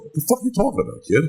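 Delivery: high-pitched incredulous retort, retold; mock indignation. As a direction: act out a boss who can't believe it. Acting out boss's angry reply.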